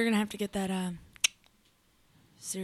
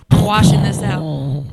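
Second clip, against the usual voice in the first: slow, much louder divine proclamation; booming God voice; 5 semitones lower, 12 dB louder, slower than usual. Acted. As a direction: thunder it down from the heavens. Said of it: "Like, God was like".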